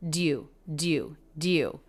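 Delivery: "Do you" run together as one syllable, "dyou". Shown as a demonstration. Teacher blending "do you" into one syllable. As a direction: join the D straight onto "you". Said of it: "just one syllable".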